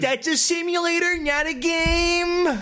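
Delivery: high-pitched pedantic nerd voice; doofus nerd. Acted. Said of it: "me being a fucking doofus, uh, uh, shallow nerd".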